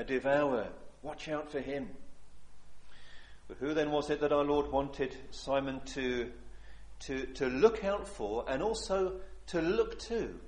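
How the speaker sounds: ordinary talk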